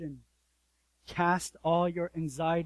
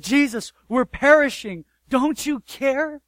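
higher, louder, slow desperate plea to Jesus; disciples crying out; 8 semitones higher, 8 dB louder than usual. Retold or imitated. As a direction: cry out as if the boat is sinking. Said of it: "They said".

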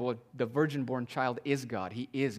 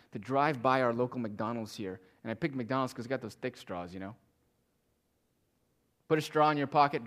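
ordinary delivery

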